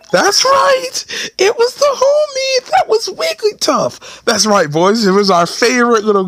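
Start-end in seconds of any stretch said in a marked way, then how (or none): 0.1-4.2 very high, drawn-out cartoon voice; squeaky, over-the-top announcement; hammed-up reveal. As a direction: sing out the reveal in slow mock falsetto.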